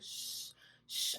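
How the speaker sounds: whispered shushing; soothing shush. Retold or imitated. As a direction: hush her like calming a child. Counselor soothing, rubbing back.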